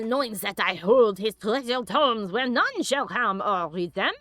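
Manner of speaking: deliberately bad French accent. Streamer reading a letter badly on purpose, French accent. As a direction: read it in a hammed French accent. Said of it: "I read it badly on purpose".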